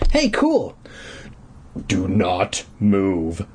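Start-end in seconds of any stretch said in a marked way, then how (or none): 1.9-3.5 slow, low villain boom; slow, low menace from the caped man; caped man's slow low command; bellowing villain. A narrator's reading. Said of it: "the man bellowed".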